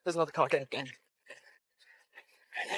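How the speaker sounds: hushed, very slow, urgent; urgent whisper. Real, not acted. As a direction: whisper it urgently, barely voiced. Fleeing, urging friend into car.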